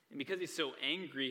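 ordinary talk

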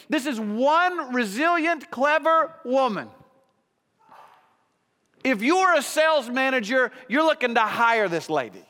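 high, loud pulpit exclamation; full-throated sermon peak; preaching at full force. Acted. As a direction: proclaim it with amazed fervor.

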